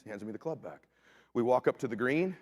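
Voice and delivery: usual speaking voice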